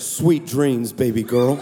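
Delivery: very low, suave goodnight; Antoine's sweet-talk voice. Retold or imitated. Texter saying goodnight to supposed Darnisha, deep and flirty. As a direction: croon it deep, as a come-on. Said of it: "It's Antoine".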